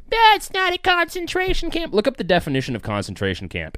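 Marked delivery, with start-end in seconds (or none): 0.1-1.9 very high squeaky mock protest; exaggerated falsetto whine of denial; mocking objectors. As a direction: squeal it like a silly naysayer. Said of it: "And when people say".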